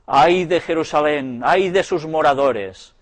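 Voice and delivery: lightly voiced prophet of woe; lamento del profeta. A narrator's reading. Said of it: "no contestaba sino con estas palabras". (lamento profético, levemente declamado)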